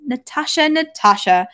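mildly anxious mock whisper; frightened whisper. A narrator's reading. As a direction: call the child with a touch of alarm. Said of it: "Countess Maria's frightened whisper".

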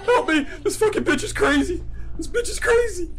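frantic laughing shouts while fleeing; laughing panic. Real, not acted. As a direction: cry out, half terrified, half amused. Chased by life lady.

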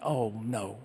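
soft, low worried "oh no" under the breath; hushed dread. Retold or imitated. Thinking to himself as footsteps approach, hushed dread. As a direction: mutter it like trouble is coming.

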